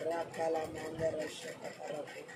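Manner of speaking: low, slow, hushed chant-like prayer; praying in tongues. Real, not acted. Preacher praying to himself between scripture readings, hushed tongues. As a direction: murmur slow syllables of tongues, soft and low.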